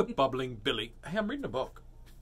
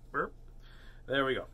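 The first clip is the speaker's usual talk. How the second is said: usual speaking voice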